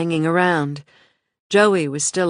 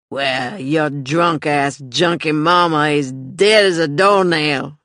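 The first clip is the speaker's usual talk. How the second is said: slow, loud, slurred sneer; drunk mean uncle; 6 dB louder, slower than usual. Acted. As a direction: cruel drunk man, slow.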